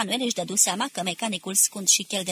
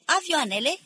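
high, called-out one-word query; shouted question; 8 semitones higher, 3 dB louder than usual. A narrator's reading. A dozen men ask about the planes, shouting. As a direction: pitch it up as a crowd's cry. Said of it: "strigară vreo zece glasuri".

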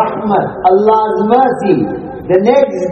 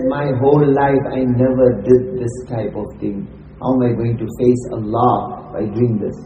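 low, softer voice of quiet dismay; subdued shame; 8 semitones lower, 5 dB quieter than usual. Acted. Woman tells Kifl of facing Allah, understated shame. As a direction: confess it quietly, sinking lower.

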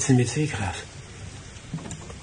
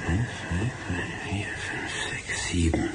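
whispered counting; 5 semitones lower, 6 dB quieter, slower than usual. Acted. Man counting the floorboards to himself in a whisper.

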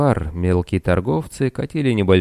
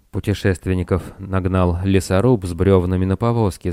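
ordinary delivery, nothing marked

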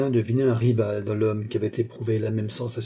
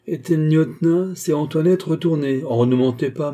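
raised, louder accusation; angry interrogation; 5 semitones higher, 5 dB louder than usual. A narrator's reading. Charny turning on the wretched Rétaux, angry and accusing. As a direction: press the culprit hard.

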